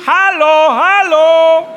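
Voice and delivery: high, loud repeated hello; loud calling. Retold or imitated. Wife calling into a bad line, loud. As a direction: shout into a dead line.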